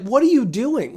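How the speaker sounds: high-pitched retold protest; indignant outcry. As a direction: imitate shocked bystanders objecting. Acts out bystanders' protest.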